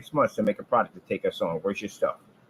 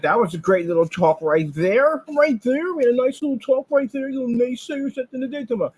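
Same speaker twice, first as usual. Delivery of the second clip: much higher, louder mocking singsong; gloating taunt; 13 semitones higher, 4 dB louder than usual. Real, not acted. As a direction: crow over them like a playground taunt. Gloating over rivals.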